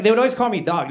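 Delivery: normal talking voice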